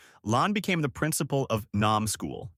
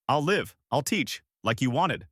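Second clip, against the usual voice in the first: usual speaking voice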